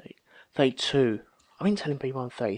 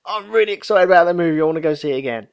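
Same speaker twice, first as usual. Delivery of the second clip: loud, quick burst of excitement; excited moviegoer raving on leaving the film; 4 semitones higher, 7 dB louder, much faster than usual. Retold or imitated. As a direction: gush it out, fast and high. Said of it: "I'm excited about a movie".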